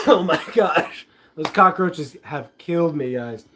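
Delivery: worn-out hoarse voice; talking through a hoarse throat. Real, not acted. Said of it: "You can hear it in my voice".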